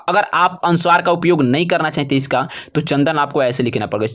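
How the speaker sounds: ordinary talk, nothing marked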